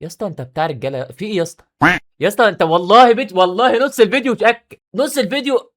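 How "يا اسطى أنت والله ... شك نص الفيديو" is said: exasperated outburst. Real, not acted. Exasperated at rival.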